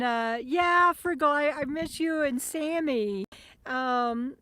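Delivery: normal talking voice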